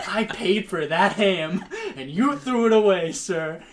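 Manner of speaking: loud, high, laughing reproach; mock indignant scolding. Customer scolds waiter for binning ham, mock indignant. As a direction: tell him off, hammed-up.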